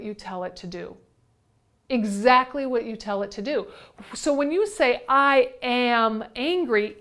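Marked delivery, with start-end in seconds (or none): 5.1-7.0 slow "I AM angry", each word weighted; emphatic, word by word. Shown as a demonstration. Teacher showing how words own anger, slowly emphasized. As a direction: drag out and press on "I am angry". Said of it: "it's taking ownership of that anger".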